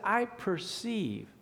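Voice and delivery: slow, weighty I perceive; slow, deliberate. Retold or imitated. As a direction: lean on each word of I perceive. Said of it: "He said".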